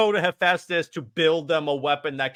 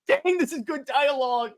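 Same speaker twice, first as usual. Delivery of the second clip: high-pitched fan enthusiasm; thrilled comic-fan exclamation; excited gushing; 10 semitones higher than usual. Retold or imitated. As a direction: gush in delighted admiration, pitched way up. Fan gushing over favorite comic dialogue.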